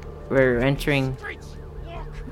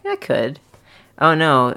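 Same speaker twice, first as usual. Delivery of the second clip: ordinary talk, nothing marked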